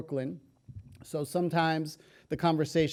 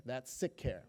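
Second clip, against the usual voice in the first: "That's sick care" dropped much softer and lower; quiet repeat; 4 semitones lower, 9 dB quieter than usual. Shown as a demonstration. Speaker repeats the point in a much softer, lower voice. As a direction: let "That's sick care" fall away to a hush. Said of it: "repeats the term quietly".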